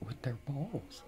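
amused whisper, holding back laughter. Real, not acted. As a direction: whisper it, trying not to laugh.

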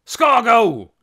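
loud, high bellow of a name; shout. A narrator's reading. Trog summoning Scargo from the house, loud shout. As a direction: call someone out at full volume. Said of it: "he shouted".

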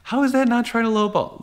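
high, disbelieving outburst on is; can you believe this guy; incredulous. Real, not acted. Dealer scoffing at a buyer's lowball claim, incredulous.

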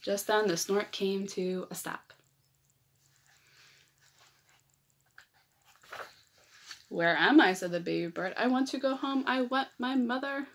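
lively picture-book read-aloud; reading to children. A narrator's reading. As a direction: read it like story time for little kids.

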